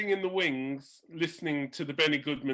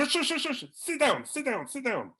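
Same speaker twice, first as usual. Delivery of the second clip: high, urgent shush and sit down; shushing old eccentric; 7 semitones higher than usual. Retold or imitated. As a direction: hush him down fussily. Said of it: "And he went".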